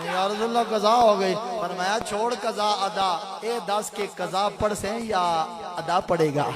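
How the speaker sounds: high, loud preaching at full force; a preacher carried away by the story, calling out loud; fiery preaching. Acted. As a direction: preach it with rising passion. Preaching climax, story voices.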